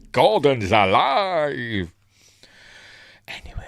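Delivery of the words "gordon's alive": loud, high, very drawn-out bellow; booming mock-heroic exclamation; Brian Blessed. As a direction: roar it like a hammy stage giant. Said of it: "That's a Brian Blessed, isn't it?".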